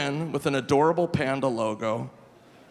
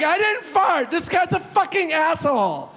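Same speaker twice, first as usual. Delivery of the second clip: high, loud outraged screech; hammed-up woman losing her temper; imitated furious denial; 5 dB louder than usual. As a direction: mimic a livid protest. Woman furiously denies and insults him.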